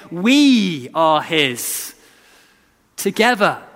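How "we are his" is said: slow, drawn-out declaration. Shown as a demonstration. Preacher voicing the church's shared reply, slow. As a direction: stretch each word out slowly. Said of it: "we sing to each other ... saying".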